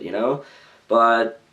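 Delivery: ordinary delivery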